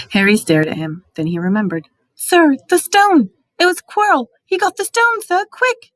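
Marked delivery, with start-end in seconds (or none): 2.1-5.9 high, loud urgent pleading; urgent alarm. A narrator's reading. Harry desperately warning Dumbledore about the stone, high and urgent. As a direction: blurt it out in alarm.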